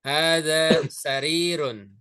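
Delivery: Hadza baitun drawn out slowly, syllable by syllable; a teacher modelling Arabic for students to repeat; slow model pronunciation. Shown as a demonstration. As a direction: say Hadza baitun slowly so the class can copy. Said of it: "Ikuti saya".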